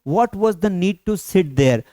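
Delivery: slow, careful teaching read of each word; slow clear classroom delivery; slow model sentence. Shown as a demonstration. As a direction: spell it out slowly for learners. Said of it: "teacher models English sentence".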